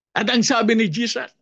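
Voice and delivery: ordinary talk, nothing marked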